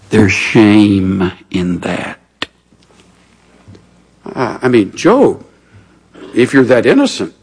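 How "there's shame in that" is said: very slow, low and drawn out; slow grave verdict. Retold or imitated. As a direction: lay it down slowly, heavy and low. Reading Eliphaz's verdict.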